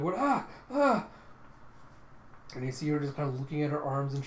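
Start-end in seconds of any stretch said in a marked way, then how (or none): 0.2-1.1 short, high, loud shrieks; freaked-out screams. Acted. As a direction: scream in panic, twice. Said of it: "Colin's just freaking out".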